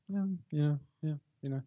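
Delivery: imitating a hushed, hesitant reply; very softly, mimicking an awkward answer. Retold embarrassed woman answering.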